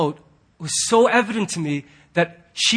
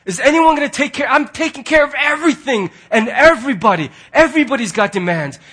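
louder, higher frantic complaint; overwhelmed man; 6 semitones higher, 4 dB louder than usual. Retold or imitated. Pastor voicing a burdened man who could shut down, frantic. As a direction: voice a stressed-out guy near his limit. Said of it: "could so easily go".

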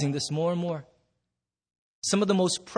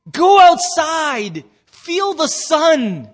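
slow, loud, higher parent yell; 9 semitones higher, 10 dB louder, much slower than usual. Retold or imitated. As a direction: bellow it like a fed-up parent. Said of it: "parents are always yelling at your kids".

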